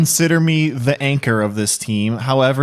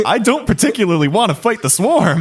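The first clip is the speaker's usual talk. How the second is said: laughing; 8 semitones higher than usual. Real, not acted. Laughing while talking.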